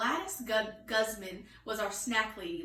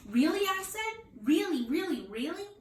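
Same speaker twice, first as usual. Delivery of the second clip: excited disbelief; 7 semitones higher than usual. Acted. Girl can't believe snack lady still works there, excited. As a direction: bounce with disbelief. Stunned by news.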